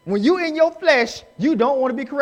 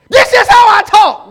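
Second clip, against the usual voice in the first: very loud, high, drawn-out holler; mock yelling; 8 semitones higher, 13 dB louder than usual. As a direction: bellow it slowly in mock self-defence. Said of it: "You don't need to yell like that".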